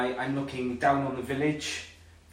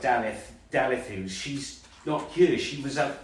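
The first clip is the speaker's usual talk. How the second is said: ordinary talk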